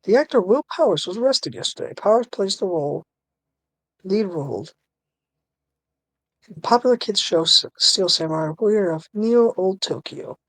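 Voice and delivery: TV news voice. Acted. Newsreader announcing an actor's arrest to viewers, lightly put-on TV news voice. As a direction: read it out like a TV news report. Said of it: "you wanna be the T V voice?".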